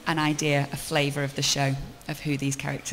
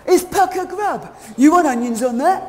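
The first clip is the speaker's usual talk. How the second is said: loud, rough kebab seller; kebab shop man; 11 semitones higher, 12 dB louder than usual. Acted. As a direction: bellow it across the counter.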